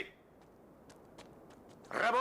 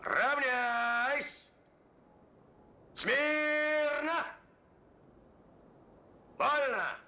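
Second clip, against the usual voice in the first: drill commands; 5 semitones higher, much slower than usual. Acted. Officer drills soldiers in line.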